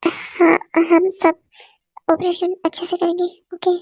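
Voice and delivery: usual speaking voice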